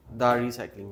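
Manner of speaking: "the recycling" with "the" said as "da"; short "da" for "the"; correct "the". Shown as a demonstration. Teacher settles on "the" before a consonant. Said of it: "after T H E there is consonant".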